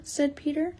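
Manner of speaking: usual speaking voice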